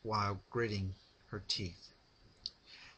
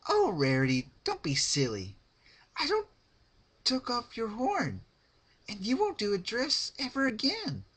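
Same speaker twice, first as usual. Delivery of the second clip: high, bright and louder; cheery cruel Pinkie; 6 dB louder than usual. A narrator's reading. Pinkie telling Rarity she will never sew again, cheerful and cruel. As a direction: chirp the cruel news cheerfully.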